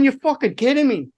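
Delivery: high, exasperated mock protest; retold disbelief. Tech finding strings still lying there, high disbelief.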